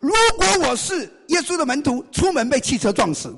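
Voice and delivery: loud, high, desperate vow; swearing an oath. Retold or imitated. Peter swears loudly to the servant girl. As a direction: swear it loudly to shake them off. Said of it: "发刺起誓说".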